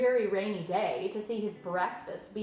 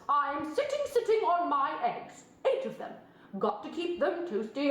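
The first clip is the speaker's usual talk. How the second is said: high, honking mother goose, louder; fussy goose; 5 semitones higher, 3 dB louder than usual. A narrator's reading. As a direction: cluck over her eggs like a proud goose. Goose's speech.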